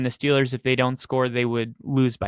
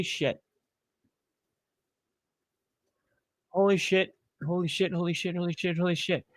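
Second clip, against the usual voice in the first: awed muttering; 4 semitones higher, 6 dB quieter than usual. Real, not acted. Fan reacting in awe.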